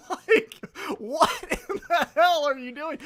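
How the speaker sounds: high, loud words through real laughter; laughing incredulous. Real, not acted. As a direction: laugh it out in disbelief. Laughing at MJF's antics.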